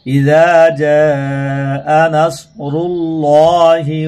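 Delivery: slow, drawn-out tajweed chant; Quran recitation. Shown as a demonstration. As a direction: recite the sura title slowly, melodically. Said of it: "বানান করে।".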